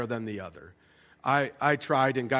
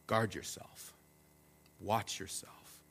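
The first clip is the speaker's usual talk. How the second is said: soft and slow, an intimate caution; a low, quiet word from God to the heart; hushed warning; 6 semitones lower, 13 dB quieter, slower than usual. Acted. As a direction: murmur it close like a gentle warning.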